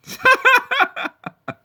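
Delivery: high amused laugh. Real, not acted. Laughing at the haters and naysayers, high laugh. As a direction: burst out laughing. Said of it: "I'm just laughing".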